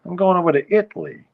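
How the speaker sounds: put-on brash old New York accent; New Yorker saying he's going to Italy. Shown as a demonstration. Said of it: "as some people used to pronounce it".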